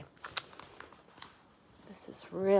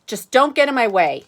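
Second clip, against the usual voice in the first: Arthur gruff; 6 semitones higher, 12 dB louder than usual. Acted. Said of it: "Arthur says".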